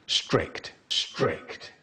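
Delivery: breathy voiceless whisper of each word. Pretend. Narrator whispering about the strict teacher. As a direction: whisper it like a secret.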